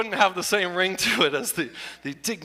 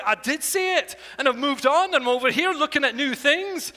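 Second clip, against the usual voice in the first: higher Scottish accent, pleased with himself; showing off a Scottish accent; 9 semitones higher than usual. Shown as a demonstration. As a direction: brag about the kilt in a Scottish accent. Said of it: "worked on the Scottish accent".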